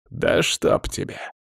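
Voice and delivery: slow, voiceless curse under the breath; cursing. A narrator's reading. Necromancer whose spells keep failing, cursing under his breath. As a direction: swear in frustration, almost whispered. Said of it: "выругался Павел".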